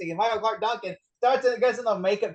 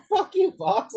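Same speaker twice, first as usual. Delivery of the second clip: higher, punchy mock outrage; mock angry retort; 13 semitones higher, slower than usual. Imitating Ron Perlman cursing at Fox, mock angry. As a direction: fling it back at the studio, mock offended. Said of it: "Ron Perlman's like".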